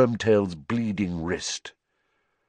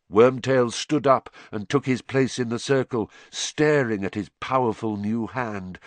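ordinary delivery

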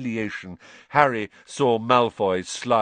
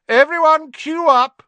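very high, loud shout to a crowd; roaring; 12 dB louder than usual. A narrator's reading. Malfoy roaring to the crowd to queue, loud shout. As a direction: yell it out like a mocking announcement. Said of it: "Malfoy roared to the crowd".

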